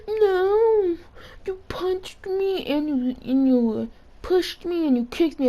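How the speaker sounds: high, wailing, tearful protest; mock-crying high whimper; crying kid imitation. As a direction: whine it out like a sobbing kid who got hit. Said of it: "He was crying on the floor".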